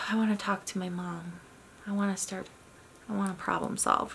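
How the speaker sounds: quiet and settled; a calmed child ready to talk; soft calm child voice. Retold or imitated. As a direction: speak gently, soothed child.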